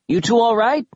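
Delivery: high, concerned yelled question; yelling. A narrator's reading. Kaz checks on the two in the pit, yelling. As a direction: call down to check on them. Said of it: "he yelled".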